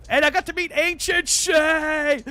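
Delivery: loud, high, drawn-out shout of joy; excited shout. Real, not acted. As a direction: burst out with the big news. Excited news about meeting friend.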